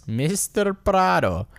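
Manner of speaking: drawn-out, high hammed-up Spanish movie accent; mock movie Spanish accent. Host playfully announces a Spanish rider's name, hammed-up Spanish accent. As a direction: stretch it out in a fake Spanish accent. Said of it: "that's just a movie accent".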